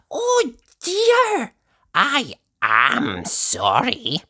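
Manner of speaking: very high oh, then slow mock apology; high, drawn-out sly sorry; Missus Washer apologizing. Missus Washer fake-apologizing to Nosey, high and slow. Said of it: "said Missus Washer".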